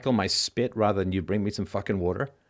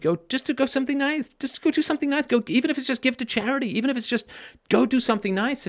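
much higher, insistent pleading; urgent plea; 13 semitones higher than usual. Real, not acted. Urging listeners to go do something nice, high and pleading. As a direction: beg them to just be nice.

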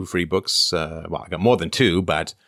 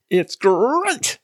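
much higher catchphrase roar with a rolled R; Tony the Tiger; 3 dB louder than usual. Acted. Host plugging his new book as a cartoon mascot, Tony the Tiger impression. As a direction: roll the R and belt it high. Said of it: "says Tony the Tiger Philosopher Head".

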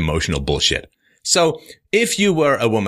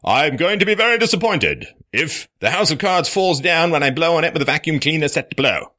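plainer version; 6 semitones higher than usual. Pretend. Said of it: "you can say".

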